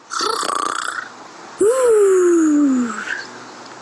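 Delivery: long drawn-in gasp of wonder; amazed gasp. Acted. Teacher amazed at the sleeping bear, long gasp.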